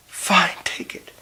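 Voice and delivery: slow, whispered surrender; slow resigned whisper; defeated whisper. Acted. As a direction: give in, all fight gone. Bride gives up the diamond.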